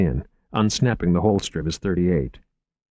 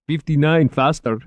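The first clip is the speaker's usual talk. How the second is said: put-on higher voice with Middle Eastern accent; thick Middle Eastern accent; 8 semitones higher, 4 dB louder than usual. A narrator's reading. Driver proposing a faster street, thick Middle Eastern accent. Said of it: "in a thick Middle Eastern accent".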